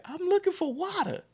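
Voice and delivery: high-pitched retold kid's plea; high imitation of a child insisting; pleading kid. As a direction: act out your younger self, voice way up. Retells own childhood plea once again.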